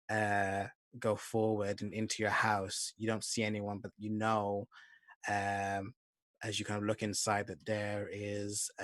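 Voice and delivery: normal talking voice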